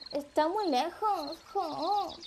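slow, drawn-out whine; complaining. Acted. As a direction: moan about it, stretching the words high. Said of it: "Cascada al ver se queja".

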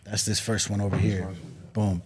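ordinary talk

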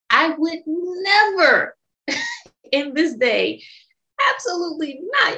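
high, slow laughing no way; laughing refusal. Real, not acted. Laughing at the idea of putting up with it again. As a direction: laugh it off while refusing flatly.

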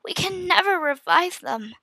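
high, despairing, close to weeping; voice breaking. A narrator's reading. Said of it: "my voice broke as I spoke".

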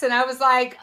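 usual speaking voice

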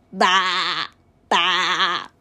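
low, wavering baas; two low quavering bleats; sheep bleat. A narrator's reading. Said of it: "Sheep said".

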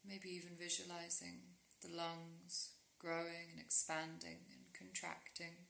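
soft, unhurried meditation voice with long pauses; guided sleep meditation. Real, not acted. Guiding a listener into rest with yoga nidra, calm meditation voice.

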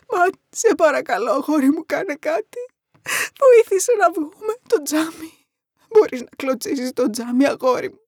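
high voice choked with crying; desperate weeping mother, pitched high; tearful, despairing plea. A narrator's reading. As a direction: beg your son through sobs. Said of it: "Τα κλάματα και η απελπισία πνίγανε τη φωνή της".

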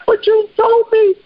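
high, slow, hurt little voice; a betrayed baby turtle whimpering it out; dying baby turtle. Acted. Turtle dying on scorpion's back, high and wounded.

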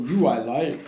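a light hint of Yoda's backwards talk. A friend quoting Yoda to a co-host, lightly voiced. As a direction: toss off a quick, lightly voiced Yoda line. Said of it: "And that Yoda guy saying".

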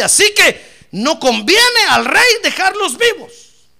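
high, slow, shouted verdict; declaimed urging. Acted. Adviser urging the king against the people, loud declaiming. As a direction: proclaim it at full force.

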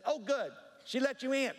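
high-pitched imitation of a pleased parishioner; Clois. Voices Clois greeting the pastor.

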